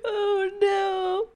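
high, drawn-out wail of dread; high-pitched groaning dismay; dismayed whine. Real, not acted. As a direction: whimper it high. Reacting to the next clip.

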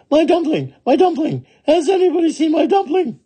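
very high, hurried calling; shouting. Acted. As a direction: shout high and fast for help searching.

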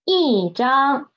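slow teaching 一张, 一 dropped in fourth tone. Shown as a demonstration. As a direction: demonstrate 一张 with a falling tone on 一. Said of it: "from the first tone into the fourth tone".

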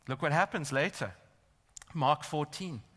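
normal talking voice

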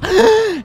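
loud high startled gasp; sudden shrill gasp of shock; sharp high gasp. Real, not acted. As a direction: gasp loudly in fright.

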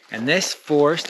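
usual speaking voice

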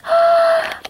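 high sharp gasp of joy; thrilled little gasp; delighted gasp. Real, not acted. Seeing the accessories.

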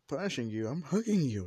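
loud, high retort; indignant mother. Acted. Mother retorts to son in story.

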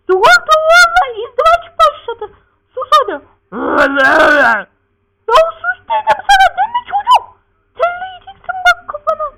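very high, loud falsetto mother voice; scolding mother. Acted. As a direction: screech it like a fed-up mother.

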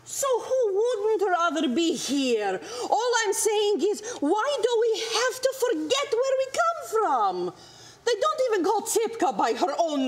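high-pitched, wounded motherly protest; exasperated protest. Acted. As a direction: pitch climbing into a pained plea. Mother argues about forgetting home.

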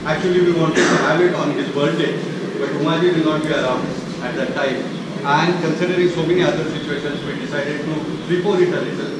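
Indian English speech; plain Indian English accent. Real, not acted. Speaker's own Indian English.

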